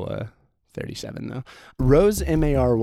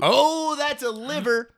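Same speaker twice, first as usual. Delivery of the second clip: surprised; 13 semitones higher than usual. Real, not acted. GM reacts to big roll.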